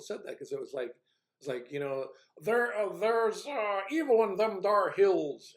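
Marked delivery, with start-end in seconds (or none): 2.4-5.5 high, loud, slow backwoods drawl; mock the line as a yokel warning about the hills; hillbilly mock voice. Mocking Galadriel's line as a backwoods rural accent. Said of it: "It just sounded so ridiculous".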